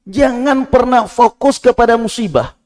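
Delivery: high, loud exhortation; preaching at full force. Acted. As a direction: urge the faithful not to complain. Exhorting the listeners.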